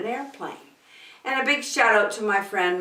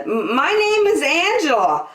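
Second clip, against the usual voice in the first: put-on scared little girl; 8 semitones higher, 5 dB louder than usual. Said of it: "Angela said".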